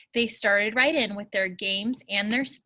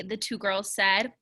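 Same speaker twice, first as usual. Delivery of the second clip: ordinary delivery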